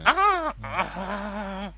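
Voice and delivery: sexy kakapo grunt; zombie moan. Acted. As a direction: grunt like an odd bird-zombie. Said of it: "don't do that one again".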